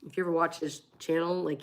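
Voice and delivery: ordinary delivery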